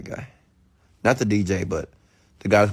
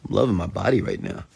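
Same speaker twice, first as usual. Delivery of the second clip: normal talking voice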